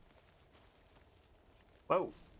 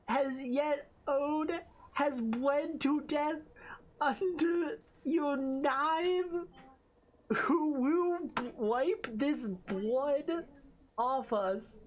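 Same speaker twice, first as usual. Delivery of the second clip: high, slow cutesy uwu voice; a squeaky baby-talk reading; 5 semitones higher, slower than usual. Acted. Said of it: "Uwu voice?".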